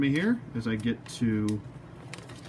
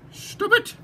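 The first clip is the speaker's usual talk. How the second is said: mock squeal; 4 dB louder than usual. Scolding the crooked helmet.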